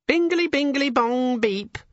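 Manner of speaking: very high squeaky chime voice; tiny electronic imp chirping. Acted. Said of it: "said a voice from Vimes's pocket".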